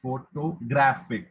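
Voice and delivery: PHOTOGRAPHIC in slow syllables, GRA punched; stress demonstration. Shown as a demonstration. Reader giving an example of word stress, photographic slow with weight on GRA. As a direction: stretch out photographic and hit GRA. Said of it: "when and where to stress the word".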